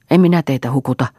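ordinary delivery

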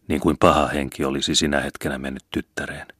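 usual speaking voice

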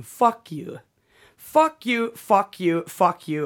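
mock yell, starting high and stepping down; retold scream. Stranger up close insulting Lance, imitated yell falling in pitch. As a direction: imitate a man repeatedly hurling an insult. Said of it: "och skrek han".

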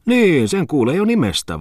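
high, loud innocent agreement. A narrator's reading. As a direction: confirm it as if guileless. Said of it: "vahvisti Oiva Tommola viattomasti". (viattomasti korkealla äänellä)

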